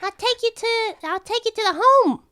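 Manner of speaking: very high squeaking rat; squeaky rat voice. Acted. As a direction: chirp like an eager rat. Rat NPC keeps talking.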